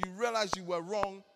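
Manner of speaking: usual speaking voice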